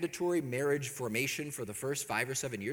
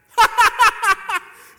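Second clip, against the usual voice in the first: a big high, loud mocking laugh; retold friend bursting out laughing at the groom; 17 dB louder than usual. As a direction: laugh right at the newlywed. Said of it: "starts laughing".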